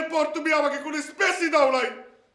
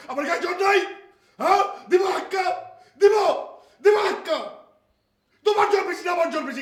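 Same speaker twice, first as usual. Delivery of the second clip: higher, shouted challenge; mock threat; 3 semitones higher than usual. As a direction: puff up and yell the threat. Husband threatens to push wife.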